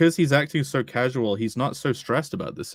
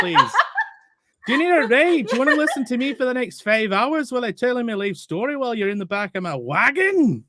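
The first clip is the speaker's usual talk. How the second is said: mocking the chatty caravan man; 12 semitones higher, 4 dB louder than usual.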